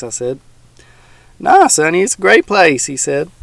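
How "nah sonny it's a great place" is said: put-on higher, louder cheerful old man; old man defending his swamp, louder. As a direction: brush off the boy's worry.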